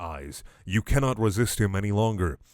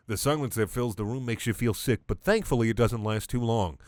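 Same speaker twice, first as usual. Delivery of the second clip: ordinary delivery, nothing marked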